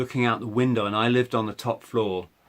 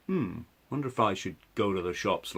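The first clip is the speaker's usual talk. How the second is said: thoughts voice; 4 semitones lower, 6 dB quieter than usual. Shown as a demonstration. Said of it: "your thoughts voice".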